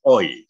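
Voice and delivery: loud single glide "Oi"; gliding oi. Shown as a demonstration. Coach showing a diphthong as one sound. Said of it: "nggak gitu ya".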